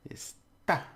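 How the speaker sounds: "Está" with a high, stabbed "tá"; stress on final syllable. Shown as a demonstration. Teacher repeating it is, stress on final syllable. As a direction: jab high on the end of "Está". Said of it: "like a little stabbing at the end".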